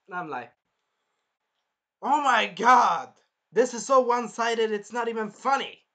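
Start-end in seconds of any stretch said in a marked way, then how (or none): 2.0-5.8 outraged disbelief. Real, not acted. Viewer reacting to the one-sided duel, loud disbelief. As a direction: gasp at the unfairness.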